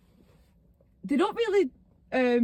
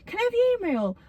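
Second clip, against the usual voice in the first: higher-pitched mimicked request; imitated shop assistant; 8 semitones higher, faster than usual. Shop staff to customer at the till, imitated assistant. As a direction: put on a pushy till worker's voice.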